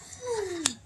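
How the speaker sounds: high mock gasp; shocked gasp. Storyteller reacts to the thieving monkeys, shocked gasp. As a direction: suck in a breath for effect.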